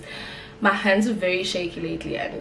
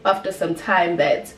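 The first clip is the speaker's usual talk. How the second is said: usual speaking voice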